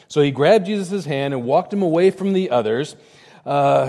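ordinary talk, nothing marked

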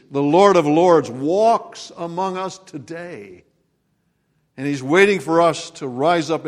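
rising, booming sermon proclamation; preaching. Acted. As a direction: proclaim it to the congregation. Preaching at full force.

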